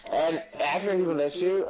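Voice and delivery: ordinary talk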